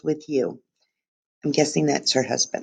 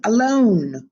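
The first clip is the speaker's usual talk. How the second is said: louder alone; 6 semitones higher, 4 dB louder than usual. Shown as a demonstration. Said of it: "didn't shout it".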